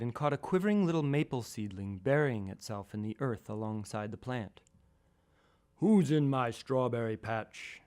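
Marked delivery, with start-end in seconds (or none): 5.8-7.8 slow, booming giant bellow. Acted. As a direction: bellow it like a giant looming over a tiny plant. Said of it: "I bellowed in my best giant voice".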